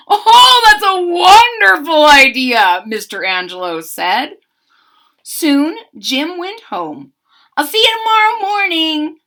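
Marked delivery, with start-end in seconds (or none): 0.1-2.8 loud high delighted baker; overjoyed at the plan; loud high cry of delight. A narrator's reading. 7.6-9.2 called out. A narrator's reading. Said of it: "he called".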